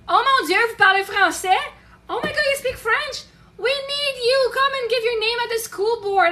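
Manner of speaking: high, loud delighted exclamation; excited cashier. Retold or imitated. Cashier to French-speaking customer at the register, excited. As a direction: gush at finding a French speaker.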